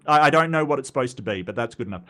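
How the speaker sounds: ordinary delivery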